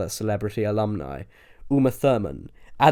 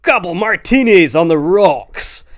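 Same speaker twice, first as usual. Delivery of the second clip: loud, slow, hammed-up American bro; fake American accent; 7 semitones higher, 9 dB louder, slower than usual. A narrator's reading. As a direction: overdo the American party accent. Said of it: "put on his best American accent".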